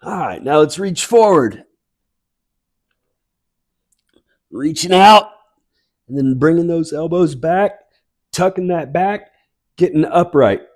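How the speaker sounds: bright, lifted workout cues over music; fitness instructor cueing. Real, not acted. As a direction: call the moves like an aerobics coach. Fitness class movement cues.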